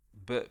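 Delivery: B as a drawn-apart "buh"; sounded-out consonant. Shown as a demonstration. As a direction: sound out B slowly before adding the vowel. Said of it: "si je fais un récapitulatif".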